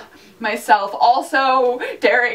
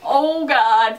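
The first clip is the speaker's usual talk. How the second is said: ordinary delivery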